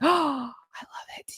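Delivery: a slow, breathless whisper of delight; gasping whisper. Retold or imitated. Fan seeing a photo of Sophie, whispering in awe. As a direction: hush it out as if the photo stole your breath. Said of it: "I immediately just went".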